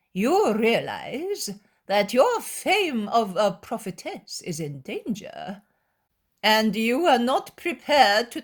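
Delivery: nasal, drawling voice. Acted. Big-nosed scribe taunting the young woman, nasal drawl. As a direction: sneer it out slowly through the nose. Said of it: "says in a nasal, drawling voice".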